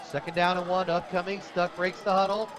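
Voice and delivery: ordinary delivery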